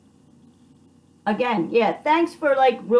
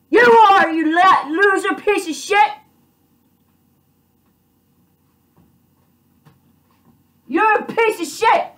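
furious insult; 5 semitones higher than usual. Real, not acted. Insulting the lawyers who wronged the speaker, angry yelling. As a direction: hurl the insult with full force.

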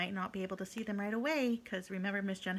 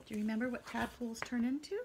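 usual speaking voice

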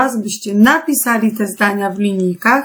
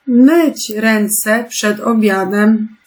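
slow reading; much slower than usual. Shown as a demonstration. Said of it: "teacher reads answer slowly".

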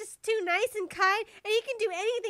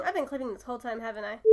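normal talking voice

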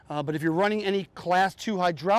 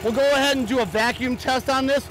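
raised, higher voice over machinery; shouting over engine; 4 semitones higher than usual. Real, not acted. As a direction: project over the running engine. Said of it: "it may be hard for you to hear me".

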